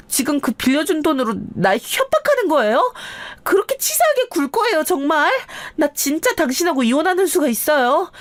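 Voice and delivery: high-pitched wife turning the threat back; indignant threatening. A narrator's reading. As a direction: protest in a high voice, threatening divorce. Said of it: "이혼 협박으로 저한테 도리어 협박을 하더군요". (높은 목소리로 발끈하며 협박하는 아내)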